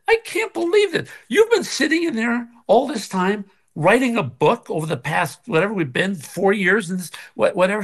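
high-pitched incredulous protest; retold disbelief. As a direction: protest in mock astonishment. Retelling indignant reaction to wife.

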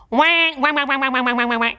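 quacking wah wah, a bit held back; a slightly shy duck laugh. Shown as a demonstration. Coach does the duck laugh along with students. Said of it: "Can you do it for me?".

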